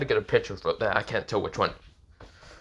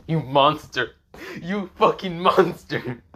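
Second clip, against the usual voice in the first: louder, drawn-out accusation; mock outrage; 6 semitones higher, 4 dB louder, slower than usual. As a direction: act appalled at the outrageous price. Acted outrage at friend's terms.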